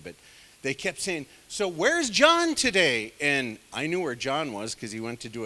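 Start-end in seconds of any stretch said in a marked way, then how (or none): 1.5-3.1 slow, pointed question from a coworker; mock innocent workplace question; coworker asking. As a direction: ask it slowly, fishing for where he is. Coworkers asking about John.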